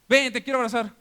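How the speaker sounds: acted-out dad calling for his son; father calling out. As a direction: call out to your boy. Voices father offering a hug.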